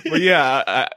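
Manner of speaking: usual speaking voice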